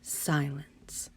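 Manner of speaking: hushed, breathy whisper on the word. A narrator's reading. Narrator hushing the room at the scary moment, whispered. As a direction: drop to a whisper for the silence. Terrible silence in story.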